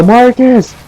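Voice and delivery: very high, urgent mock yell; re-enacted panicked shout; retold yell. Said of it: "we just yell".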